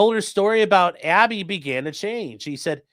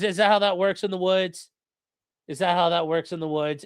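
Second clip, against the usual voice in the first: normal talking voice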